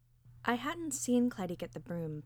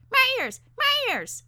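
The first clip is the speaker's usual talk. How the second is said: very high, loud parakeet shriek; piercing, squeaky elfin cries; elfin scream; 12 dB louder, slower than usual. A narrator's reading. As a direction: screech in pain like a tiny bird. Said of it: "screamed his elfin voice".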